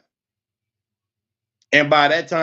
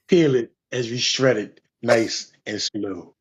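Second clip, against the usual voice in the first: mock ASMR; 6 semitones lower, slower than usual. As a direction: hush and slow down on nice and slow. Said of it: "I do A S M R with cucumber".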